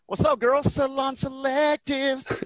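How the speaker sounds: high, drawn-out commercial catchphrase; playful ad quote. Retold or imitated. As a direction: stretch the brand name out high and playful. Quoting an ad jingle at friend.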